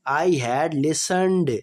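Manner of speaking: slow, clear dictation pace; slow classroom example sentence; slow example read. Shown as a demonstration. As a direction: spell it out slowly for students. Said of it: "teacher reading tense example".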